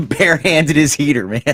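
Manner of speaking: cracking up as he repeats it; laughing. Real, not acted. Laughing at the barehanded catcher story.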